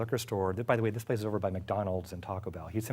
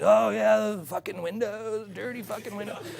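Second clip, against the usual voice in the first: much higher, slow slurred grumble; drunk editor ranting; 10 semitones higher than usual. Retold or imitated. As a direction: grumble like a drunk big guy.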